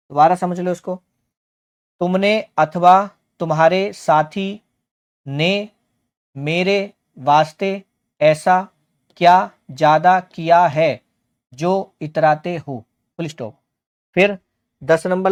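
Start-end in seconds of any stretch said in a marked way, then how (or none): 2.0-12.8 slow phrases with rising ends; teacher reading aloud for learners to follow; deliberate rereading. Shown as a demonstration.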